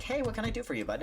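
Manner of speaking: high chipper employee greeting; cheery clerk. Retold or imitated. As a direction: greet him like an upbeat store worker. New clerk greets him.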